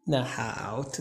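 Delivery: usual speaking voice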